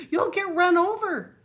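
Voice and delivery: mother duck in a dither, squawking; flustered squawk. Acted. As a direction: panic like a frightened duck. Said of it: "all of a dither".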